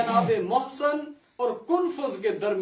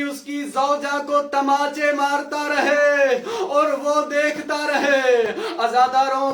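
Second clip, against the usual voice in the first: preaching at full force; 3 semitones higher, 5 dB louder than usual. Acted. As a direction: drive the grief home to the mourners. Majlis climax of mourning.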